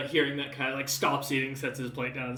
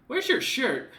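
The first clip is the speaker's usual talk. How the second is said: NPC voice; 10 semitones higher, 5 dB louder, slower than usual. Acted. Officer NPC asks teasingly.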